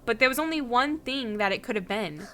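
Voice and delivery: usual speaking voice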